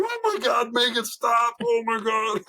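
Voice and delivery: high-pitched mock pleading; mortified. Teenager watching dad grab the teacher by the neck, mortified plea. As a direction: wail it like a mortified kid. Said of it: "absolutely mortified".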